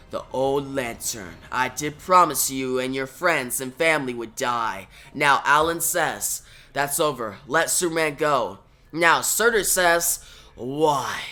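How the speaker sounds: low, soft, looming threat from a fire demon; menacing giant voice. Acted. Fire giant taunting the heroes before battle, low and menacing. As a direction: speak like an ancient fire giant sizing up prey.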